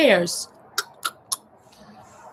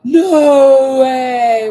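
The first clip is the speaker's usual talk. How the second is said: loud, high burst of thrilled disbelief; delighted shout; 5 semitones higher, 13 dB louder than usual. Real, not acted. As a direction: cry it out, amazed by the result. Delighted at her crochet result.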